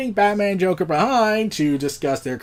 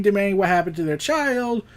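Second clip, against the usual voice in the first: ordinary talk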